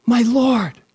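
a high, amazed cry of recognition; awed exclamation. Acted. Mary sees risen Jesus.

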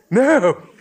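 high, loud cry of disbelief; startled outburst, pitched high; shocked. A narrator's reading. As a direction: exclaim in girlish horror. Girl shocked at the overdose.

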